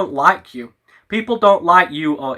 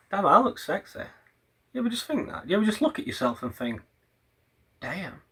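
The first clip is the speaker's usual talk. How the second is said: soft, self-admiring, softer; smug murmur; 11 dB quieter, faster than usual. Real, not acted. Admiring himself on camera, soft and smug. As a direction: purr it to yourself, pleased.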